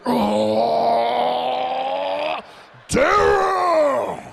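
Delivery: loud, high, hammed-up "Oh, damn!"; mock shout. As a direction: bellow it like the guy up top. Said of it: "He was like".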